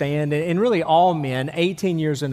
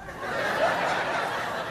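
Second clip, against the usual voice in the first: breathy laugh; 8 dB quieter than usual. Real, not acted. Pastor laughs at own joke.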